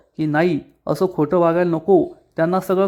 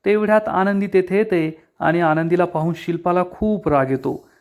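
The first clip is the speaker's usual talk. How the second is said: ordinary delivery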